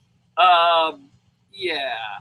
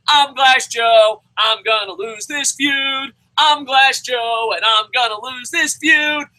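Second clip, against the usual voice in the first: loud, slow, higher mock loser voice; mock Glass Joe; 7 semitones higher, 4 dB louder, slower than usual. Ranter imitating Bray as Glass Joe, loud slow mock voice. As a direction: shout it out slowly like a doomed boxer. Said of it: "I hear".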